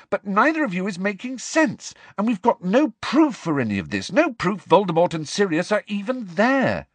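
high-pitched, agitated protest; crying out. A narrator's reading. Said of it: "cried Hermione".

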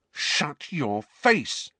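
drawn-out, seething order; angry retort. A narrator's reading. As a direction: warn him word by word. Bully enraged by teasing.

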